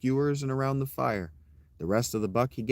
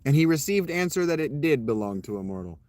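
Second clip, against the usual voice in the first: plain reading voice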